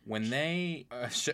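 ordinary delivery

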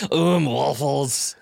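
put-on very slow Arnold accent; long-drawn Austrian accent; Arnold Schwarzenegger accent. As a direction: echo it in a stretched Arnold accent. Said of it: "our man Arnold would have done it".

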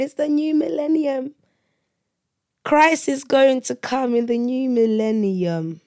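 high-pitched mock-excited proclamation; imitated hype. Everybody in church heralds the millennium, imitated excitement. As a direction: mimic a hyped crowd, pitched well up.

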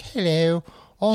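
hammed-up posh greeting; posh accent. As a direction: put on a posh princess voice. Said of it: "She sounds posh now".

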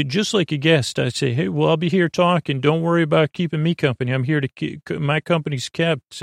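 ordinary talk, nothing marked

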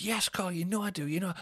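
high-pitched put-upon moaning; retold exasperation. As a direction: imitate a hungover woman fed up with questions. Sophie snaps back in retold quarrel.

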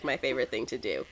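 normal talking voice

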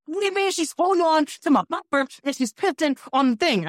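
high, indignant outburst; outraged driver. Retold or imitated. Driver complaining the customer is peeing on his car, high outrage. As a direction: squeal it out in disbelief.

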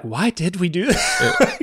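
high, slow, regretful question; shaken regret. Retold or imitated. Shaken kids after being caught by dad, slow high regret. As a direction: imitate shook kids questioning themselves. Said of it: "we were all sort of, like, shook".

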